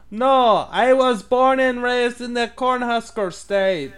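put-on high, slow mixed French-Baltic accent; fake foreign accent. Caller insisting he is from Nebraska, in a slow fake accent. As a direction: deny being Russian in a thick put-on accent. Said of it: "I think I went, like, French and some kind of Baltic".

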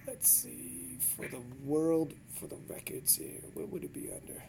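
very quiet, half-voiced mumble; low, absent-minded murmur; muttering to self. Real, not acted. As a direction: think aloud under your breath while searching. Muttering while looking up records.

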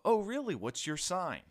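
high, loud, eager imitation of a nosy questioner; mimicked eager questioner. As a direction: play the clueless person asking. Mimics people asking his star sign.

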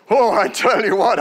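usual speaking voice

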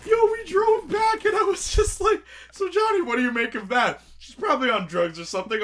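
laughing. Real, not acted. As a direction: tell it while still cracking up. High laughing retelling of drive.

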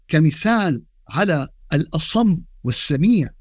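normal talking voice

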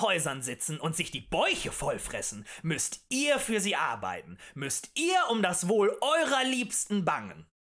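rousing agitator's declaration; emphatic oratory. A narrator's reading. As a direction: champion the common people. Agitator harangues the tavern crowd.

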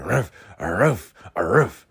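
gruff-free spoken "aroof" woofs; imitated dog bark. Mocking the dog next door that barks at him, imitated barks. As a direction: mimic a dog's repeated barking.

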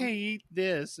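imitating a high, wimpy kid; wimpy high-pitched voice. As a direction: squeak out a weak taunt in a high voice. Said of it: "a very wimpy voice. It's very high-pitched".

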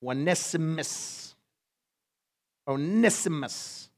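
"Onesimus" said slowly, oh-NISS-ih-mus, softly; slow careful pronunciation. Shown as a demonstration. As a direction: spell out "Onesimus" syllable by syllable. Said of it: "some of you would say Onesimus".

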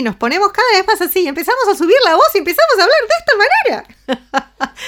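nervous high voice. Shown as a demonstration. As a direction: climb up like a nervous woman. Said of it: "cuando estamos nerviosas nos ponemos cada vez más así". (voz nerviosa cada vez más aguda y rápida)

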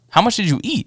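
high-pitched alarmed question; mock concern. Co-worker questions the gummy bear eater, mock alarm. Retold worried question about edibles.

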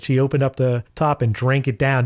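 normal talking voice

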